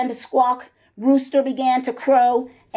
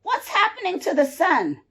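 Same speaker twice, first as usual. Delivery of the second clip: alarmed rooster; 8 semitones higher, faster than usual. Acted. Rooster alarmed at vanishing sun.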